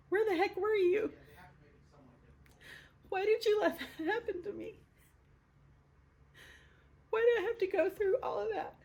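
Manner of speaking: high-pitched anguished plea; raw, real questioning. Retold or imitated. Woman confronting God over what she went through, raw and anguished. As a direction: demand answers in a high, strained voice. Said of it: "So I got really real".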